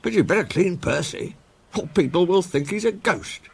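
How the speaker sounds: high, amused signalman voice. A narrator's reading.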